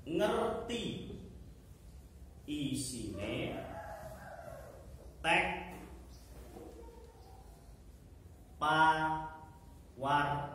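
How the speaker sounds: word by word, long pauses between; dictating. Shown as a demonstration. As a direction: dictate very slowly for pupils to write. Repeats the point for note-taking.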